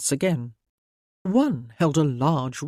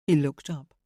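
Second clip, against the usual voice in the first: ordinary delivery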